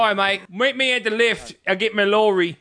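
high-pitched put-on British chatter; hammed-up British mate; mock British accent. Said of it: "If you say it in an Australian accent".

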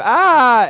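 high, loud comic scream; mock scream. Shown as a demonstration. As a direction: scream it like a fake fright. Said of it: "put my hands up and scream".

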